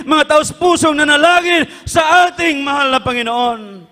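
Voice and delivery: high, ringing preacher's cadence; fervent pulpit preaching; full-force preaching. Acted. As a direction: drive it home to the congregation.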